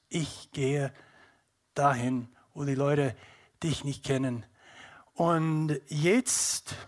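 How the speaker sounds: usual speaking voice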